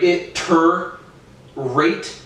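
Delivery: "It, ter, rate" as three detached beats; separated syllables. Shown as a demonstration. Showing two R sounds, "It, ter, rate" broken apart. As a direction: pause between "It", "ter" and "rate". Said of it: "I'm still pronouncing two R sounds".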